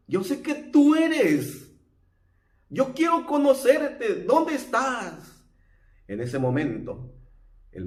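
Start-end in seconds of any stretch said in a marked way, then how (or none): 0.1-5.4 eager plea. Retold or imitated. Blind man voiced pleading.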